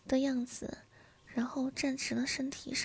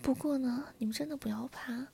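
usual speaking voice

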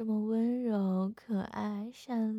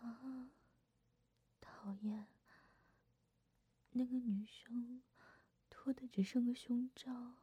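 shocked whisper; 2 semitones higher, 6 dB quieter than usual. Acted. Shocked by couple in park.

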